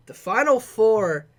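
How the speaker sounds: big announcement. Real, not acted. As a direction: build it up for the reveal. Announcing the final four picks.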